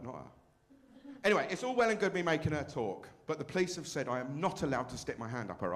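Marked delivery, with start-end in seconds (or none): 0.0-1.1 whisper aside. Acted.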